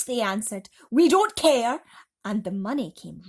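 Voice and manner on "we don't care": loud, high careless shrug of a voice; crowd reply. Acted. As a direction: toss it back at her. Crowd answers the aunt.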